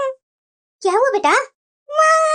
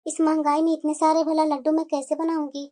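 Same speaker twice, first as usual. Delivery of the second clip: much lower, softer hesitant mother; worried mother voice; 12 semitones lower, 6 dB quieter than usual. Acted. Mother worried about costly laddoos, low hesitant voice. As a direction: fret about the expense.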